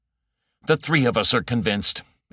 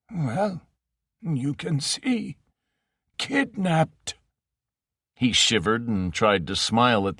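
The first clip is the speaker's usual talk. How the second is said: put-on old faint quavering; 4 semitones higher than usual. Frail Ocken greeting his old friend, faint quaver. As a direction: quaver like a broken old man. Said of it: "a voice old and faint and quavering".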